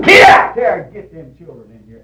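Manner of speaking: loud shrieked opening, then easing; mock yelled order; imitated squall. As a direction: squall like a bossy spouse. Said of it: "his wife squall out at her".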